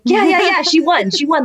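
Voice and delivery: high, eager retold confirmation; excited reassurance. Teammates tell mom her daughter won, excited. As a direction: imitate teammates cheerfully confirming a win.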